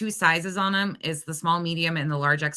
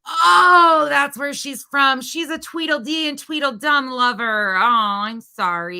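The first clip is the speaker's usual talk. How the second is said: mocking sympathy; 6 semitones higher, 7 dB louder than usual. Real, not acted. Reacting to where she's from, mocking sympathy. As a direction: gush with exaggerated fake understanding. Mocks the woman in the clip.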